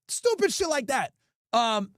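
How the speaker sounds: ordinary talk, nothing marked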